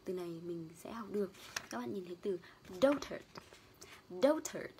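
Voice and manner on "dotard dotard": dotard with stress on DO, second syllable tut; DOtard, clear teacher model; stress on first syllable. Shown as a demonstration. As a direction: say DOtard, weight on the first part. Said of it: "trọng âm rơi vào từ đầu".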